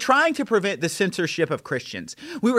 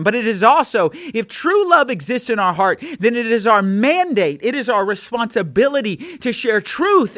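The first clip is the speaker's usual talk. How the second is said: impassioned preaching; 5 semitones higher, 4 dB louder than usual. Acted. Preaching at full force.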